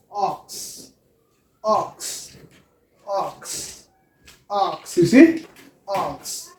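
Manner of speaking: ox said very softly and clearly; soft model word. Shown as a demonstration. Teacher drilling ox with the class, soft and careful. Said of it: "for example, we have what".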